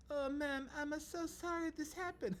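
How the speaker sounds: put-on high, soft apologetic Chinese-accented clerk; imagined Chinese-accented apology. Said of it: "what does she have to say".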